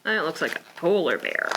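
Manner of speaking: usual speaking voice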